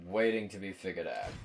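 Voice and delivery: ordinary talk, nothing marked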